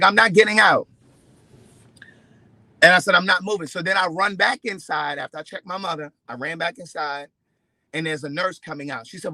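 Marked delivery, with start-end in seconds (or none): 0.0-3.7 loud, stubborn refusal; defiant yell. Retold or imitated. Refusing the valet guy, loud and defiant. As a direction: plant your feet and shout it.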